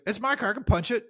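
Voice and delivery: high-pitched mock protest; imitated arguing man. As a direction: imitate an indignant, irrational man insisting. Retold argument with the man.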